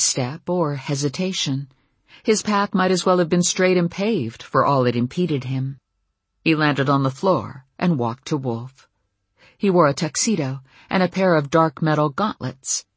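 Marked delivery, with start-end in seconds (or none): none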